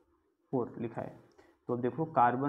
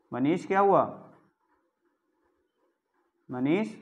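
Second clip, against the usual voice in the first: louder, higher questioning call; raised-voice check on a pupil; calling on a student; 3 semitones higher, 6 dB louder than usual. Real, not acted. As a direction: call out to an inattentive student by name. Teacher calls out to a student.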